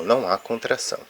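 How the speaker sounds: ordinary talk, nothing marked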